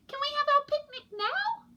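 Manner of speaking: put-on very high, small child voice; little penguin voice. A narrator's reading. Penguin chick Pog pleads with parents, squeaky high. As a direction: ask like an eager tiny chick. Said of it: "said Pog".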